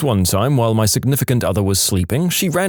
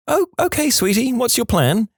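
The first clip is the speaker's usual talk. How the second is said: very high voice for a wife; high-pitched, indulgent wife; 13 semitones higher than usual. Acted. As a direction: humor a sleepwalker in a high wifely voice. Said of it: "She asked".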